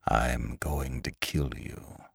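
very soft, low, slow threat; slow it right down, almost under the breath; quiet menace. Acted. Lantry with knife at the man's chest, quietly menacing.

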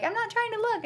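high-pitched mock protest; imitating the co-host. Quoting co-host avoiding the pictures, high mock protest. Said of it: "then you're like".

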